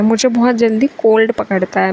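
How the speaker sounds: usual speaking voice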